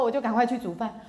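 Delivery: usual speaking voice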